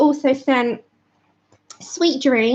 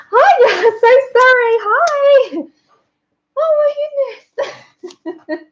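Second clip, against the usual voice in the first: flustered; 10 semitones higher, 4 dB louder, slower than usual. Real, not acted. Flustered greeting at the door.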